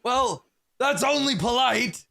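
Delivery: high, slow creature voice; bugbear reply. Acted. Bugbear answers the stranger down the corridor, high drawn-out voice. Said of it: "one of them says".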